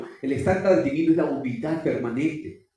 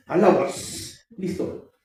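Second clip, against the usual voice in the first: usual speaking voice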